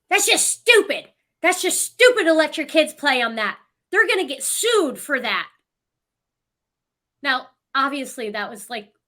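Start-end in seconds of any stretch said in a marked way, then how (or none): 0.1-5.5 high, loud scolding voice; shaming stranger. Retold or imitated. Stranger shaming kids on a play structure, scolding. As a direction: imitate an outraged woman telling parents off. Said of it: "starts shaming these kids".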